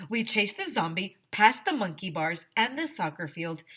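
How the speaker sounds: usual speaking voice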